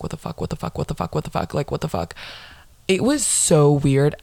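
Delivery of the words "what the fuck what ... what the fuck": stunned, repeated under-the-breath whisper; rapid, barely voiced self-talk; whispering to oneself. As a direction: whisper it to yourself in disbelief. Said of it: "I started just whispering to myself".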